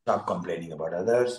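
usual speaking voice

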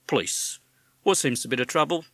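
brisk official on the phone; police officer. Acted. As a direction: policeman answering an emergency call. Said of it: "a voice said".

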